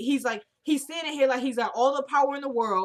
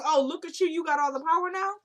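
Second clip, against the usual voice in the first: higher, playful mock awe; teasing; 7 semitones higher than usual. Fiancée playfully teasing her man, higher. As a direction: tease him about his sudden power.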